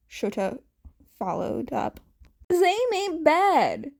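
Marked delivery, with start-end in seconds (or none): 2.5-3.9 high, loud defensive retort. Acted. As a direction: snap back in his defense. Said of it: "Snipe retorted".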